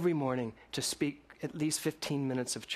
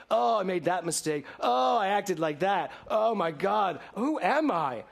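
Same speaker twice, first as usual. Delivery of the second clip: higher, louder mock self-reproach; mock dismay; 5 semitones higher, 5 dB louder than usual. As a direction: play the fretful believer who beats himself up. Acting out self-blaming people.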